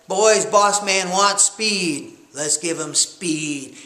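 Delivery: normal talking voice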